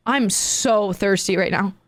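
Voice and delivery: slow, low, drawn-out creepy request; eerie stranger. Retold or imitated. Man refusing to go inside, eerie. Retelling the strange man's fixation.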